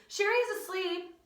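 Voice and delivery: high, loud schoolkid telling on someone; tattling. Acted. Pupil tells teacher a classmate is napping, tattling.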